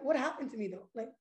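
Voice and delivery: softly whimpering in imitation of crying; hushed, mildly weepy retelling; mock tearful self-pity. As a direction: play the hurt victim, quietly. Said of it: "now I'm cry".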